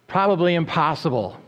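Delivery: ordinary delivery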